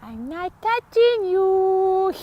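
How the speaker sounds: high, slow, singsong taunt; teasing sibling. Retold or imitated. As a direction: taunt like a bratty sister. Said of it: "My sisters and I would be like".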